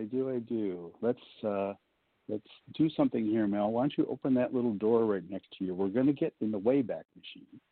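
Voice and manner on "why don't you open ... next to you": ordinary delivery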